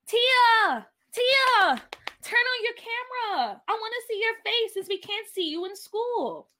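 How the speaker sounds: high, loud excited calling out, easing in volume; excited high-pitched shout settling into eager talk. A narrator's reading. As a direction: call out to a friend, thrilled. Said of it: "Peach said excitedly".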